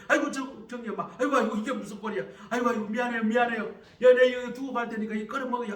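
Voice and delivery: loud, high, flustered babbling; embarrassed deacon apologizing loudly to the mother; flustered apology. Acted. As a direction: stammer out apologies while backing away.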